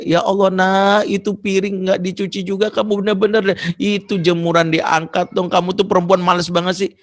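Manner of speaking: high-pitched exasperated scolding; acted motherly complaint, pitched well up; tired mother nagging. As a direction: nag a lazy daughter about chores. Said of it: "Saya kau pulang karena capek".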